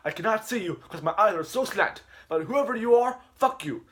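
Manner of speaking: high, loud, squeaky teacher voice; teacher scolding late student, high and loud; mock teacher voice. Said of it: "Jackie Chu says".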